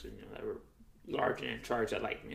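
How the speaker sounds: usual speaking voice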